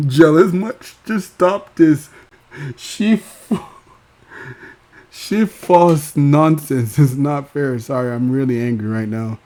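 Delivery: high, loud mock tantrum voice; imitating Raven's tantrum. Raven mocked, yelling at her producers about YouTube. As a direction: throw a hammed-up fit at the producers. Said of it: "she will take it out on the producers".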